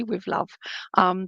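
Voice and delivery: ordinary talk, nothing marked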